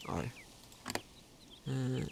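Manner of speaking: ordinary talk, nothing marked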